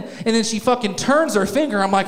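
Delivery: usual speaking voice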